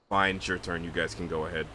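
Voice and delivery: casual dismissal. Retold or imitated. Fighter leaving the enemy to others, low casual dismissal. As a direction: hand it over as if nothing happened. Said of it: "It's just like".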